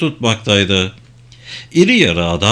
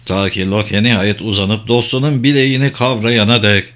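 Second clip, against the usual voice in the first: ordinary delivery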